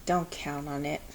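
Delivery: put-on a glum kid thinking it; sulky thought. A narrator's reading. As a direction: grumble it under your breath.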